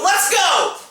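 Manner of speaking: high-pitched retold call to fight; imitated cop's battle cry; fight challenge. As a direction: call him out to brawl. Cop challenges man to fight.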